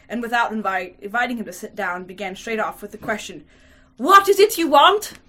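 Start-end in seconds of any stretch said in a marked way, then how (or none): 4.0-5.1 loud, high, slow challenge; stern inquiry. A narrator's reading. Hostess confronting an unexpected late visitor, stern and loud. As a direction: demand it sternly, voice raised and drawn out. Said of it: "with a sternly inquiring look".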